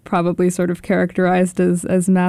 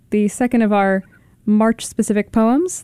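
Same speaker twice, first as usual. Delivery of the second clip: normal talking voice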